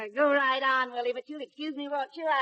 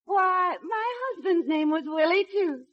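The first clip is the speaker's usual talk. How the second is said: delighted surprise; 6 semitones higher, 4 dB louder than usual. Acted. Delighted at the shared name.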